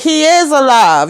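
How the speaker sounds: "He is alive" pitched high with weight on "is"; emphatic retold protest. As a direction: lean hard on "is" in imitated indignation. Retold father's protest in a quarrel.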